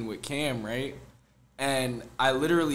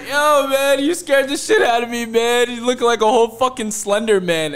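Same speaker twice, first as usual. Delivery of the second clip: higher, louder, laughing panic; startled shout; 11 semitones higher, 9 dB louder than usual. Retold or imitated. Host jolted awake yelling at his friend, loud and high. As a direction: bellow like you just got spooked awake.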